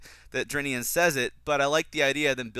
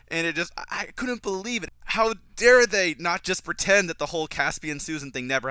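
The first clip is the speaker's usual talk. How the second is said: high, loud outraged rant; indignant disbelief; 6 semitones higher, 7 dB louder than usual. Real, not acted. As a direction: protest as if personally affronted. Indignant rant over romance reference.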